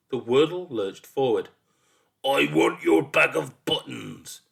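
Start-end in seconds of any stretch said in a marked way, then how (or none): 2.2-4.4 loud, slow, higher monster demand. A narrator's reading. Monster robbing a boy of buttons, demanding. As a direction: demand it slowly in a big voice. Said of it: "demanded in a new voice".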